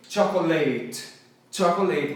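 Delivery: "Chocolate" ending like "late"; common mispronunciation. Shown as a demonstration. Teacher imitates learners' mistake, "Chocolate" mispronounced with a LATE ending. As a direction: say "Chocolate" wrongly as CHOCK-oh-LATE. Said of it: "a galera tende a falar o quê?".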